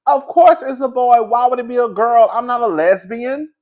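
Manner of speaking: put-on matter-of-fact child, a bit offended; niece indignant. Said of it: "she said".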